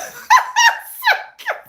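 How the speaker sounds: loud, high shrieking laugh; high shriek of laughter. Real, not acted. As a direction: burst into a squealing laugh. Laughing at herself.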